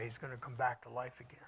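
fast confiding whisper; quick whisper. Real, not acted. Confiding hope to congregation.

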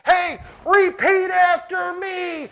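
loud, high, slow hammed-up street-preacher shout; mock pushy shout. Pastor mocking a pushy witness at Walmart, hammed-up shout. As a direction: bark it at a stranger in the aisle.

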